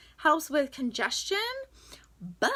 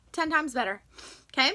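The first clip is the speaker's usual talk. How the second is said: ordinary talk, nothing marked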